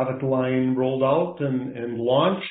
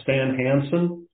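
ordinary delivery

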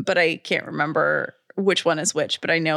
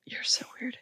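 whispered tease; 13 dB quieter than usual. Real, not acted. Amused reaction to odd joke.

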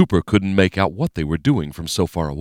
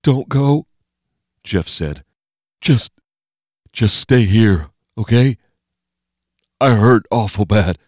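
high, pleading, frightened voice; scared plea; 4 semitones higher, 5 dB louder than usual. Acted. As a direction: beg him to stay, hurting. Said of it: "Normal and scared".

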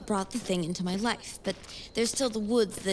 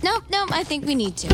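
higher, insistent push back; 7 semitones higher, 4 dB louder than usual. Acted. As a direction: refuse to let it drop. Arguing back with her.

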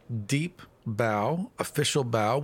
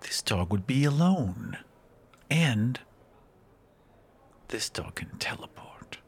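cold sweet ancient calm. Acted. Fairy continues, lowered to whisper.

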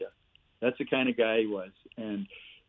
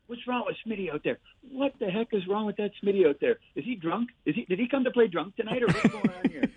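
imitating angry coach; 7 semitones higher than usual. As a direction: ham up the furious coach yelling from the bench. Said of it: "he's going".

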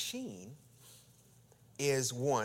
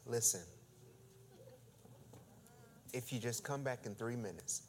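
softer, patient plea; calm restraint; 3 semitones lower, 13 dB quieter than usual. Retold or imitated. Preacher quoting himself to the pushy man, quiet and patient. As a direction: quietly reason with him. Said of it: "I say".

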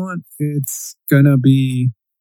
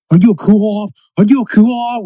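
higher, louder mock Boston accent; bad Boston accent; 7 semitones higher, 5 dB louder than usual. Mimicking annoying people quoting The Departed, bad Boston accent. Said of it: "with that bad Boston accent".

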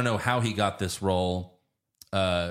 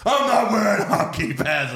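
much higher, louder cartoonish Batman retort; Pete Holmes Batman impression; 11 semitones higher, 5 dB louder than usual. Quoting the Pete Holmes Batman parody, high and loud. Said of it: "the Pete Holmes ones".

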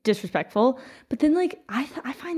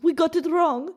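put-on higher-pitched echo of a slight Russian accent; copied Russian accent; 7 semitones higher than usual. American diplomat repeating the minister's words, in his slight Russian accent. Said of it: "in his accent".

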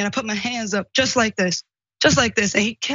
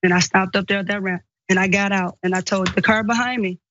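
ordinary talk, nothing marked

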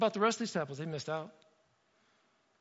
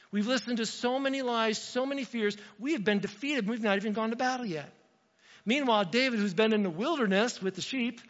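ordinary talk

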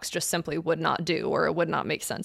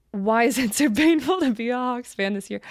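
laughing while reading; 5 semitones higher than usual. Real, not acted. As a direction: question read through a laugh. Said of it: "rereads the question laughing".

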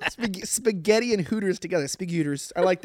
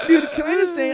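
laughing; 11 semitones higher, 4 dB louder than usual. Real, not acted. Laughing after compliment.

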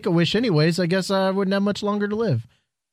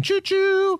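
train whistle. Retold or imitated. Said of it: "Get behind the Mahal train".

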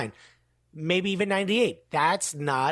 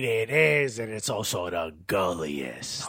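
movie villain voice; 7 semitones lower, 4 dB quieter than usual. Real, not acted. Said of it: "I'm sounding like a movie villain".

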